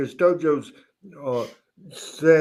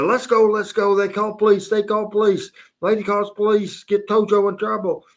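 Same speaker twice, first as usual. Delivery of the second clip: panicked Tojo; 7 semitones higher than usual. Retold or imitated. Tojo panics about police.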